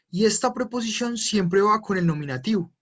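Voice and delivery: ordinary talk, nothing marked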